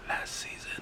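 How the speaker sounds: soft unvoiced whisper. Real, not acted. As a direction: drop to a breathy hush. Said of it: "why are you whispering?".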